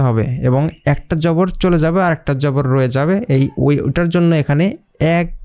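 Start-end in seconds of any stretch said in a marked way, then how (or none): none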